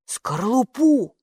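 drawn out slowly, falling; slow finishing word. A narrator's reading. Kapo finishes the guess about the eggshell, slowly. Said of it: "закончил Капо".

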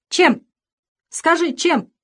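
loud, high, insistent demand; hasty interruption. A narrator's reading. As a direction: cut in sharply, demanding an answer. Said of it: "торопливо перебила Варвара Петровна".

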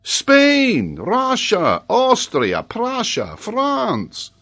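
high, loud roll-call of countries; excited professor reeling off a list; exasperated listing. Acted. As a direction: fling each name out emphatically. Paganel exclaims the forgotten states.

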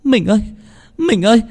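very high, frantic cries for help; wife shrieking for her husband; desperate screams. A narrator's reading. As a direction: scream as she's dragged away. Wife dragged away crying.

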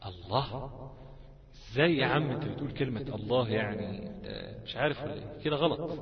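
imagined objector. Acted. Voices an imagined objector challenging him.

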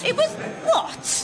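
high, drawn-out disbelief; incredulous. Acted. Local aghast at Shakespeare's London talk, incredulous.

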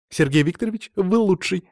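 high, gushing praise; thrilled girl's exclamation; overjoyed. A narrator's reading. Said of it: "аж подпрыгнула Полина".

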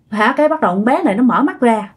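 usual speaking voice

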